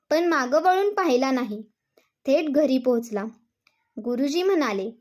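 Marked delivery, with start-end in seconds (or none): none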